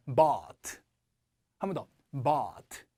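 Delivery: but said as bought, long open BAWT; dropped-jaw vowel. Shown as a demonstration. Teacher modelling for students, but said as long open bought. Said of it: "턱을 내리시고".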